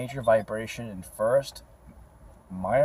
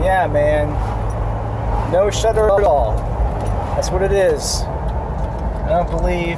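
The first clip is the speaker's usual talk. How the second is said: excited; 7 semitones higher, 6 dB louder than usual. Real, not acted. Celebrating fixed clutch.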